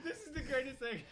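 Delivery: high giggly delight; laughing. Real, not acted. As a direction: laugh through the words. Laughing at the bit.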